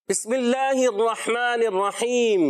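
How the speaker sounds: slow, measured recitation for the class to repeat; teacher leading a slow recital. Shown as a demonstration. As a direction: recite it slowly so pupils can follow. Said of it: "توجہ کے ساتھ مل کر پڑھنا ہے میرے ساتھ".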